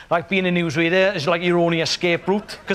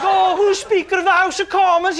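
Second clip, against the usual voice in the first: put-on high, breathy-free girlish question; 12 semitones higher than usual.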